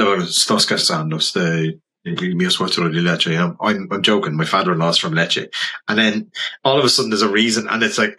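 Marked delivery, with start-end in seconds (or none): 0.3-3.4 lower-pitched, put-on Italian accent. Photographer revealing his prank to Italians, Italian accent. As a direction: switch into a convincing Italian accent. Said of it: "switch and put an Italian accent on".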